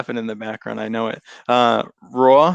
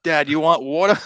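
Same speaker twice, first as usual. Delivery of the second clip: daughter mocking Boston accent; 2 semitones higher than usual. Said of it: "My daughter does it too".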